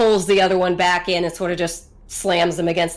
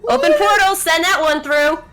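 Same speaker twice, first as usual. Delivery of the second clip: commanding villain; 7 semitones higher, 5 dB louder than usual. Acted.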